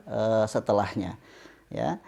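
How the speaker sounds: ordinary talk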